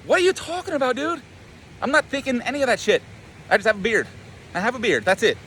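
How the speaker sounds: high-pitched exasperated denial; acted defensive protest. He defends his beard to the imagined guy, high exasperated protest.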